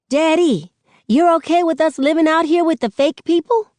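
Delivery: put-on a small boy testing his dad; little boy voice. A narrator's reading. Narrator voices young Sakani.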